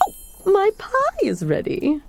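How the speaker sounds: normal talking voice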